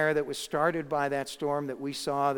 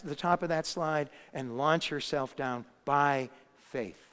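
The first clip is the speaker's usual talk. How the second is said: ordinary talk, nothing marked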